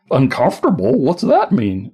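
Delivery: put-on a quick Elizabethan accent, confused. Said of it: "my Elizabethan accent".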